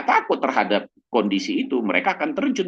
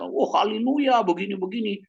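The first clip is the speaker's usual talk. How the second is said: mock gushing testimony; 12 semitones higher than usual. Showy believer gives testimony, mock gushing. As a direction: ham up the showy believer's praise.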